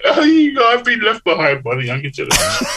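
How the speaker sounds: high-pitched mock wail; mock lament. Author bemoaning younger speakers' accent, mock lament. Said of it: "It's like".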